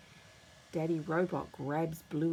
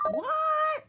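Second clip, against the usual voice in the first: very high, loud startled yelp; very high, loud squeak of surprise; shocked shriek; 8 dB louder than usual. A narrator's reading. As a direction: shriek in alarm. Shock at escaped guinea pig.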